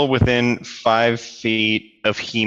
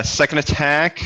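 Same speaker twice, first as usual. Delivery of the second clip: ordinary talk, nothing marked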